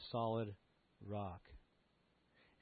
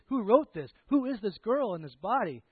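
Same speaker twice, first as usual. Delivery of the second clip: fervent preaching; 7 semitones higher, 9 dB louder than usual. Acted. Preacher's search, rising fervour.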